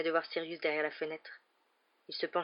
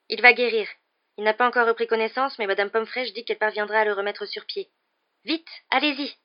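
loud, higher hurried urging; urgent reassurance; 4 semitones higher, 8 dB louder than usual. Acted. Harry hurrying Sirius away, loud and urgent. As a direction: reassure fast, then push him off.

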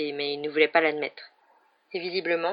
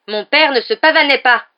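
higher and much louder, indignant; angry Harry; 8 semitones higher, 13 dB louder than usual. A narrator's reading. Harry angrily defends his father against Snape's insults.

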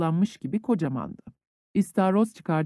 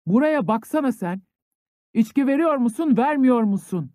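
higher and louder, demanding; raising his voice; 6 semitones higher, 3 dB louder than usual. A narrator's reading. Drunk conscript demanding vodka from the headman, raised voice. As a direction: push the pitch up as the demand builds. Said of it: "dedi sesini yükselterek".

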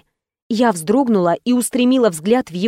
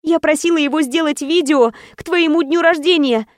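higher, blurted quickly; a girlfriend blurting the truth; 6 semitones higher than usual. Acted. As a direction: blurt it all out at once. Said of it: "выпалила я".

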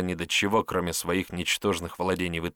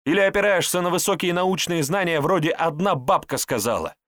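higher-pitched scornful tirade; sarcastic character, indignant; 7 semitones higher than usual. Acted. Character challenging the herbalist's medical knowledge, sarcastic and indignant. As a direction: needle him with mocking rhetorical questions.

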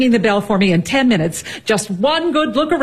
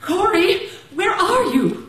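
calling out; 6 semitones higher, 3 dB quieter, slower than usual. Acted. Mother calls from stairwell.

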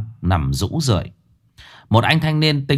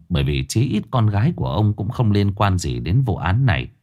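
usual speaking voice

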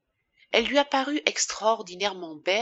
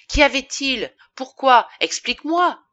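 high, loud, insistent demand; frantic questioning; 7 semitones higher, 8 dB louder than usual. A narrator's reading. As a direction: press for answers, distraught. Frantic husband demands an explanation. (questions affolées et pressantes)

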